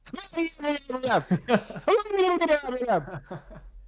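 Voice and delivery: a high, thin imitation of a laugh track; imitated canned laugh. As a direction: mimic a laugh playing in the clip. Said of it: "And then all you hear is".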